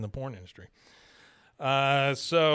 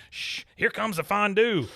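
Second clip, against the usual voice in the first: Dale voice; 8 semitones higher, 4 dB louder than usual. Retold or imitated. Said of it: "He goes".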